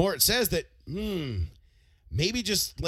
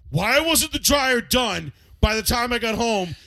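angry dad; 7 semitones higher, 6 dB louder than usual. Retold or imitated. Dad yelling about the dryer not being done. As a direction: yell it like a furious dad. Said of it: "He's like".

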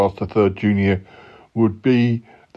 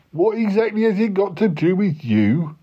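higher mocking echo; 9 semitones higher than usual. Other character echoes it higher.

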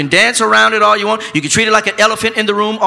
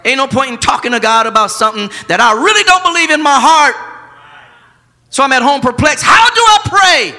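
preaching at full force; 4 semitones higher, 4 dB louder than usual. Acted. Preacher voicing his struggle to the church, shouting. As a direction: build the sermon into a loud, pleading cry.

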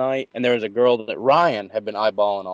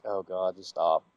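low, much softer mutter; muttered plea; 6 semitones lower, 11 dB quieter, slower than usual. Retold or imitated. Stuck tipping the dancer through half a song, muttered plea. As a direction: mutter a weary plea under your breath. Retelling his silent plea at stripper.

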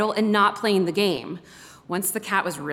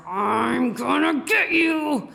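slow, higher, shouted dog thoughts; eager dog voice; 6 semitones higher, 4 dB louder, much slower than usual. Retold or imitated. Grown dog charging at cats, slow eager shout. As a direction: voice the dog lunging at a cat. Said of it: "A ninety-pound muscled mass of".

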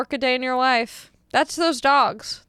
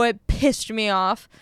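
usual speaking voice

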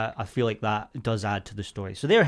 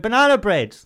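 mock heckler; 9 semitones higher, 8 dB louder than usual. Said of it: "And she went".

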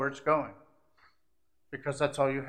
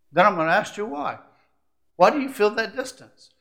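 preaching challenge; 6 semitones higher, 5 dB louder than usual. Acted.

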